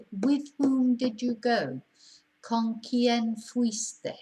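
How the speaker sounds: slow, careful example question; slow model question. Shown as a demonstration. Teacher modelling Spanish word order, slow and deliberate. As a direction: spell out the question slowly. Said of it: "you would say".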